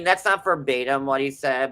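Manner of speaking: ordinary talk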